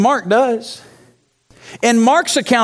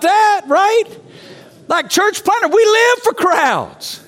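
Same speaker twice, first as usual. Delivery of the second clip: preaching at full force; 10 semitones higher than usual. Acted. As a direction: rouse the room like a revival preacher. Fired-up preaching to church planners.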